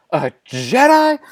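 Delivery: slow, high-pitched repeat; outraged echo. Acted. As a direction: stretch it out high in mounting outrage.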